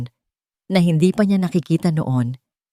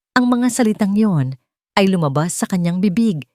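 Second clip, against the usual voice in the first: ordinary delivery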